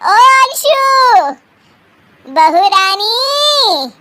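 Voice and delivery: long drawn-out singsong summons; calling out. Acted. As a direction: call into the next room, stretching every name. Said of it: "अभी बुलाती हूँ".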